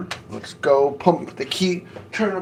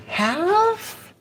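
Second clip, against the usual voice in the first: high squeaky questioning word. Real, not acted. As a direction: squeak it up in doubt. Surprised at own cooking time.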